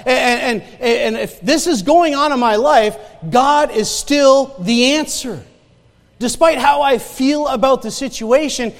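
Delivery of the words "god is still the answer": slow, weighty stress on God; slow emphatic. Real, not acted. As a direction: press the truth home. Preaching emphasis.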